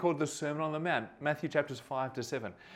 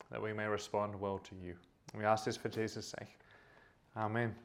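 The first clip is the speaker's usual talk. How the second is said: quiet, lower prayer voice; 5 semitones lower, 5 dB quieter than usual. Real, not acted. Pastor opening the Bible talk with prayer, quiet and low. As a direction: pray softly to open the talk. Pastor prays before the sermon.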